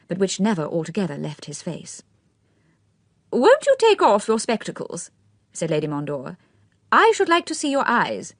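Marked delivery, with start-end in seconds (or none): 3.3-5.1 put-on high, fluttery elderly aristocrat; old lady's voice. A narrator's reading. Lady Mondor asks. 6.9-8.3 put-on high, fluttery elderly aristocrat; old lady's voice. A narrator's reading. Lady Mondor asks.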